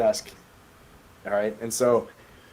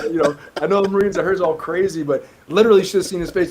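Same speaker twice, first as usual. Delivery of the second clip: ordinary delivery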